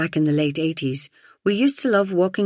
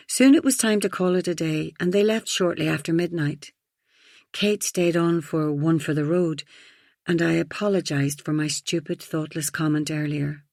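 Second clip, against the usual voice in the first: usual speaking voice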